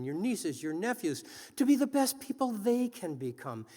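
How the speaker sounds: rousing speech to a crowd; podium speech. Acted. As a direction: urge the audience to act. Rousing call to action.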